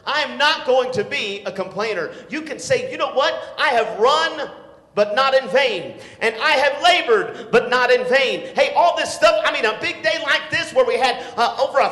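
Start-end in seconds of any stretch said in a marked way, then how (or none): 0.0-2.1 firm, loud preacher declaring; preaching vow. Acted. Preacher declaring to the youth, firm and loud. 2.9-8.5 loud, slow, ringing Bible quote; preaching at full force. Acted. Preacher quoting scripture to the church, loud and slow.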